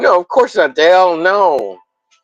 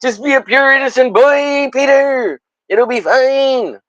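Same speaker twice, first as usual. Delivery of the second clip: high, drawn-out mock sobbing sorry; hammed-up apology; 10 semitones higher than usual. Streamer begging Peter's forgiveness, in a high mock cry. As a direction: overplay the tearful apology. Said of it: "And I'm like".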